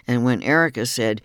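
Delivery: ordinary talk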